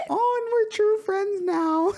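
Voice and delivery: very high, slow squeaky voice; cute villager voice. Pretend. Reading the villager's line, very high. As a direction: squeak it like a tiny cartoon villager.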